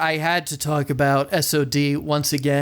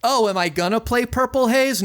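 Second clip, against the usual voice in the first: higher, sing-song fake question; mock teasing; 6 semitones higher than usual. Imitating a band faking out listeners, mock teasing. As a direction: feign a promise to the crowd.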